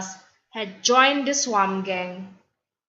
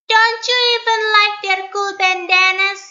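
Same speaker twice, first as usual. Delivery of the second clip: much higher, fast, loud lilting voice; sing-song girl; 12 semitones higher, 5 dB louder, faster than usual. Acted. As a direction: gush about the trend in sing-song. Stella enthusing, sing-song.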